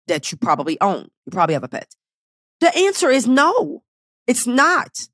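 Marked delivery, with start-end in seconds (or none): 2.6-5.1 emphatic no. Real, not acted. Emphatic rhetorical answer.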